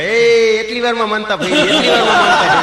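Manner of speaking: high, loud, laughing yell; shouting husband. Retold or imitated. Husband yelling after his wife jumps in the well, high, loud and laughing. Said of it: "પતિદેવ રાડું પાડવા માંડ્યા".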